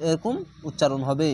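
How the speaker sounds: ordinary talk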